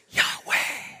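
unvoiced breath of the name, like breathing life in; breathed Yahweh. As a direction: exhale the name as pure breath. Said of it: "goes like this".

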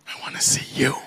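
whispered longing to God; soft, breath-only prayer; hushed plea. Acted. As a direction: drop into an intimate whisper. Intimate plea to God in sermon.

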